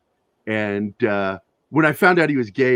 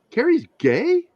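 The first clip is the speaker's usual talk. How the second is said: high, stunned question; can't believe what you just heard; retelling a moment of real surprise; shocked disbelief; slower than usual. Retold or imitated. Retold shock at friend's news.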